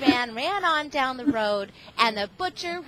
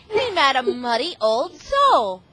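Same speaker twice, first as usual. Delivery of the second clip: ordinary delivery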